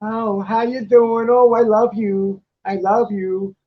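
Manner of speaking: slow, sugary mock-sweet beggar voice; lightly voiced sweet-talking panhandler, drawn out; a hint of a panhandler buttering people up; imitating a panhandler. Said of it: "she's got a way about it".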